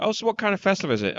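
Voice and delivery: a lightly voiced nosy coworker; curious coworker. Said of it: "people are like".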